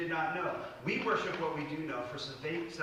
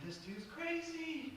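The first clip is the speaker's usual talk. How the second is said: high, soft mock-skeptical voice; a light put-on of her doubting him; skeptical woman; 12 semitones higher, 8 dB quieter, much slower than usual. Woman at the well thinking, a high soft skeptical mutter.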